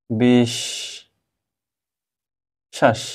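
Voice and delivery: বিশ then শ্বাস, slow with a long pause between; split-syllable demo. Shown as a demonstration. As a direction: spell out বিশ্বাস by its two syllables. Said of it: "নিচে লিখে দিচ্ছি". (বিশ্বাস দুই ভাগে ধীরে ধীরে)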